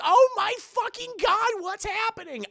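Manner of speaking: shrill high panicked squeal; hammed-up terror; mock panic. [name] watching the arm hacked off, high mock panic.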